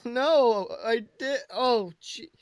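usual speaking voice

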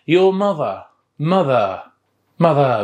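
mother in a British accent, no final R. Shown as a demonstration. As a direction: say mother British style, R dropped. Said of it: "تلفظ لهجة بريطانية".

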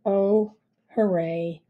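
bored, toneless hooray; emotionless. Acted. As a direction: cheer with no joy at all. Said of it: "with no more emotion than before".

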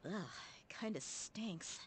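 slow whispered ugh of disgust. Acted. Dia smelling her father's old box, whispering in disgust.

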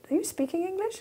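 high, soft, puzzled American interviewer. Retold or imitated. American interviewer asking the nervous student, high and puzzled. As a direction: mimic the baffled American. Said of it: "a totally funky accent here".

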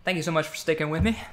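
normal talking voice

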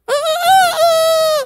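a high, loud cock-a-doodle crow; rooster crow. Acted. Preacher crowing like the rooster to the congregation, high and loud. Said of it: "the rooster said".

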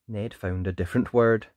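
usual speaking voice

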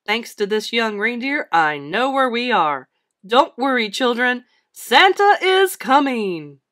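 loud, jolly call, building; hearty holler rising to a big finish; rallying Santa. A narrator's reading. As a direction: proclaim it to every child, stretching the end. Santa calls out to the children.

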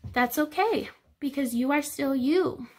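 normal talking voice

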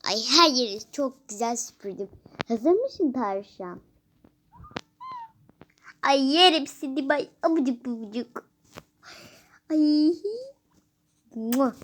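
loud, gushing, cheerful play voice; happy voice. Pretend. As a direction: coo over the bunny with delight. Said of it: "sesimi birazcık mutlu yapıyorum". (tavşana sevinçle, yüksek sesle seslenen mutlu ses)

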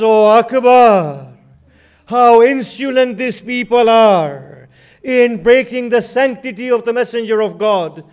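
rising, impassioned elegy for Karbala; mournful majlis recitation. Acted. Preacher recounting Akbar's death to mourners in lamenting chant. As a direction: chant the tragedy with swelling grief.

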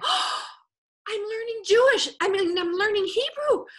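high, loud, delighted exclamation; thrilled. Retold or imitated. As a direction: gasp it out with sudden delight.

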